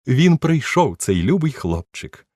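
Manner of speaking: high, loud burst of joy; gushing delight. Acted. Duchess greeting her darling, high and gushing. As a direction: gush over him like a doting lady.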